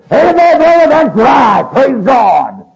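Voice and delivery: loud, high Southern evangelist shout; Oliver Green. Retold or imitated. Evangelist cheering on the brother speaking, boisterous Southern shout. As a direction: holler boisterous approval in an old Southern accent. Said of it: "old Southern accent".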